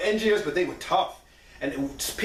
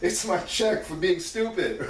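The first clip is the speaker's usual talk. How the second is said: slow wry reply. Retold or imitated. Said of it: "And I'm like".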